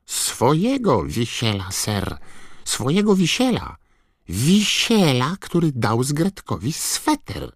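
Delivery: high, squeaky elf voice; Dobby house-elf voice. A narrator's reading. Dobby explaining. (wysoki, piskliwy głos skrzata)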